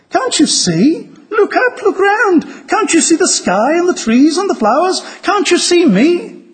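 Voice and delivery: high-pitched, imploring storybook heroine; acted urgent appeal, pitched well up; urgent pleading. A narrator's reading. Lucy urges blind dwarf to see.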